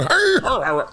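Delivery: high, far-off mock shout of an early footballer; a lightly hammed-up caller; old-timey call. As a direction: holler it like a player from the eighteen hundreds. Mimicking early football players calling.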